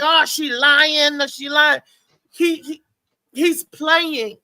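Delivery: ordinary talk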